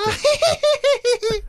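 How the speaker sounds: put-on high, slow girly voice; girl voice. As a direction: squeak it out like a girl. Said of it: "Give us a girl voice".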